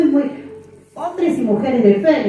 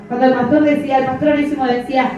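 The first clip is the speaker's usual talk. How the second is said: ordinary talk, nothing marked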